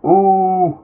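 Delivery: a high pained ooh at the thought of shattered glass; wincing ooh. Acted. Picturing a player breaking the backboard glass, a high wincing ooh.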